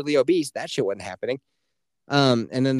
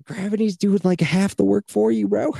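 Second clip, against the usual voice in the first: mock exasperation; 8 semitones higher, 4 dB louder than usual. Mock yelling at stair crowd.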